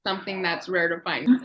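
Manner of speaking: ordinary talk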